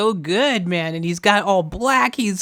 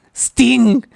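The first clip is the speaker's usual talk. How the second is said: ordinary delivery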